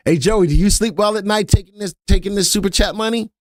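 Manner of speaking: high-pitched mock accusation; teasing accusation. Real, not acted. Host teases co-host about sleeping at night, high mock accusation. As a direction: needle Joey, pitched well up.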